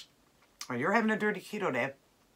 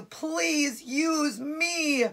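pleading body fat voice; 10 semitones higher, much slower than usual. Pretend. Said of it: "It's screaming at you".

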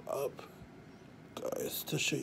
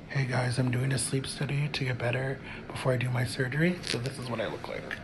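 normal talking voice

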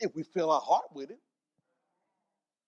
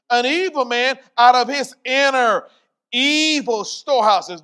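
loud, slow, raised-pitch proclamation; forceful scripture reading; 7 semitones higher, 12 dB louder, slower than usual. Real, not acted. Preacher reads good and evil treasure verse, full-force declamation. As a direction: belt out the verse word by drawn-out word.

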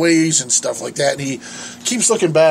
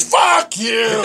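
mock angry curse; 4 semitones higher than usual. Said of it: "He goes".

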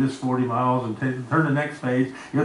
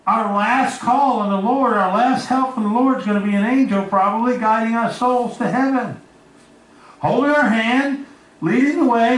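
preaching climax; 7 semitones higher, 4 dB louder than usual. Acted. Preaching climax about heaven.

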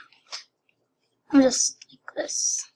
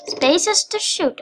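"Spaces to shoot" higher and drawn out; emphatic repeat; 5 semitones higher, slower than usual. Real, not acted. Player repeating the controls to viewers, emphatic. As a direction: stretch "Spaces to shoot" slowly, pitched up.

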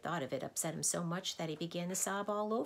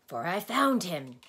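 louder, insistent storyteller's character voice; insistent doctor; 3 semitones higher, 8 dB louder than usual. A narrator's reading. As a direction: push on over the interruption. Doctor talks over the interruption.

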